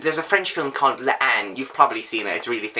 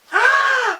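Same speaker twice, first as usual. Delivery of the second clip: a big, much higher, louder theatrical gasp; high mock gasp; 4 dB louder than usual. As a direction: gasp as if scandalised. Mock outrage at Dickens having a plot.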